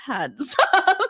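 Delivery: high whiny laughing plea; mock embarrassed kid. Kids pleading with their embarrassing dad, whiny. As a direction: whine it like a mortified teen.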